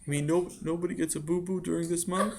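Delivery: put-on high child's question, rising; innocent little boy. Sammy asks father about boo-boos, high and innocent. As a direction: ask it like a puzzled kid.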